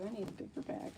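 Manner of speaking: a hushed aside while packing; muttered under the breath; whispered aside. Real, not acted. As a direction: whisper it to yourself.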